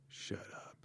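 very soft, voiceless whisper; brooding movie hero. Retold or imitated. Brooding driver brushing off the diner waitress, in a whisper. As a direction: whisper it like a brooding man of few words. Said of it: "he's very brooding".

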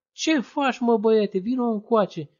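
put-on high, loud call to the boy; a workman shouting at a boy. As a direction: call him over, alarmed. Stranger calls the boy over.

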